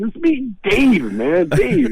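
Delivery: high, pleading voice of a friend locked outside; mock Cheech begging to be let in; annoyed Cheech at the door. Friend locked out in the heat, high pleading plea. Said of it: "finally he goes".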